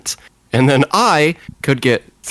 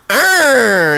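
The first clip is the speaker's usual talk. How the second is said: hammed-up, higher monster growl "Arr"; mock monster roar; 7 semitones higher than usual. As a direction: growl like a playful Frankenstein. Frankenstein growls in the story.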